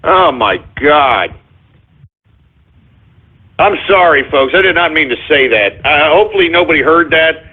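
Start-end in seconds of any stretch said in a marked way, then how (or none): none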